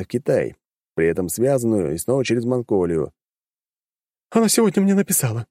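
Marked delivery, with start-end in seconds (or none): 4.3-5.5 light sobbing. A narrator's reading. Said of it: "начала рыдать женщина".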